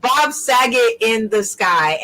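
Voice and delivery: ordinary talk, nothing marked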